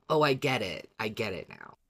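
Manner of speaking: usual speaking voice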